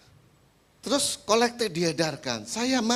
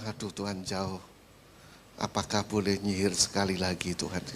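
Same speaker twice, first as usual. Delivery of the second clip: low, soft retold prayer; sheepish plea; 11 semitones lower, 8 dB quieter than usual. Believer asks God for one more spell, low and sheepish. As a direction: ask God timidly, keeping it down.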